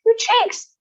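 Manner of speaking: high loud scream. A narrator's reading. Mother screams at daughter's cheeks, high and loud. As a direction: scream in horror. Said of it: "screamed Missus Beauregard".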